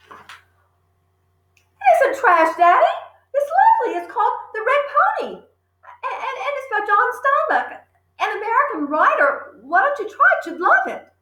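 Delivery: put-on high, girlish voice, pleading; little girl pleading. Matilda pleading with her father about her book. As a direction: coax him like a small girl.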